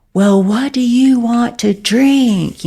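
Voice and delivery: over-enunciate as if to someone who can't hear; talking down. Retold or imitated. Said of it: "treating me as if, like, I couldn't hear".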